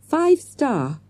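plain reading voice

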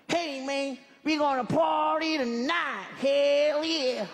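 high, drawn-out American party-guy voice; American slang accent. Shown as a demonstration. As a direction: whoop it up in hammed-up American slang. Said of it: "there's a American English slang".

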